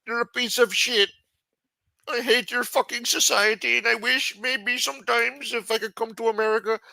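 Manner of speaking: put-on very high, loud foreign-accented caricature; squeaky put-on foreign accent ranting at Americans; Johan accent. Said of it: "let me do it in the Johan accent".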